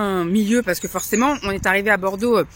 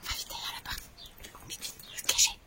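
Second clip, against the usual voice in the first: whispering; 12 semitones higher, 12 dB quieter than usual. Real, not acted. Hiding from the arriving dogs.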